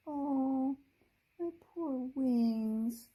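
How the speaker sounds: a touch of tears on the words; tearful. Acted. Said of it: "her eyes filled with tiny tears".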